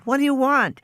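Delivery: lightly voiced frightened girl; small scared girl calling back; high child voice. A narrator's reading. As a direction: answer high and anxious.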